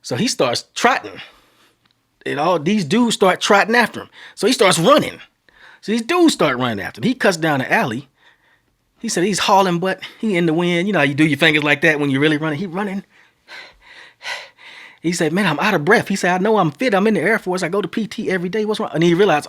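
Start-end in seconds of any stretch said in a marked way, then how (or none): 2.4-13.0 high, loud breathless chase telling; excited storytelling. Real, not acted. Frantic chase narration. 15.3-18.9 puzzled panic mid-sprint; panicked quote. Retold or imitated. Brother winded while running.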